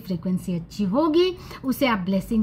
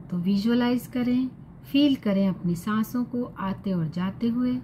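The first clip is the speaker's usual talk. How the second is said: calm meditation guide, unhurried with long pauses; guided meditation; 5 dB quieter than usual. Acted. Guiding listeners to breathe and visualize purple light, calm meditation voice. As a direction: gently lead them through it, softening at phrase ends.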